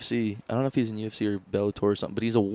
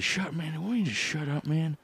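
higher, softer mock Biden; a hint of an old man; lightly voiced politician; Biden shut up man; 6 semitones higher, 7 dB quieter than usual. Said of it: "we got this".

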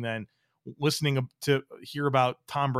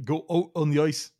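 put-on Minnesota accent; 3 semitones higher, 4 dB louder than usual. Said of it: "you went a little, uh, of Sweden there".

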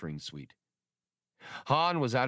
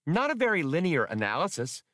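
raised, chirpy little droid voice; piping droid; 8 semitones higher, faster than usual. Acted. Blue Max correcting the others, piping. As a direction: pipe up like a small eager droid. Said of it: "Blue Max piped up".

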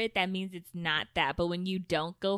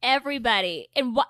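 loud call over the group; shouting; 5 semitones higher, 6 dB louder than usual. Real, not acted. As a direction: raise your voice to everyone. Shouting at friends over chat.

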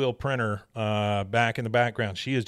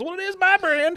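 squeaky high indignant voice; indignant shopper; 5 dB louder than usual. Retold or imitated. Hoarder defending his brand, high squeaky huff. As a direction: shoot way up into an offended squeak. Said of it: "He goes".

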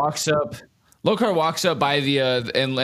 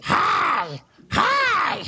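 screeching crow hi; shrill crow yell. Acted. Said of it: "yelling hi in his shrill crow voice".

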